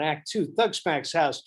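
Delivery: normal talking voice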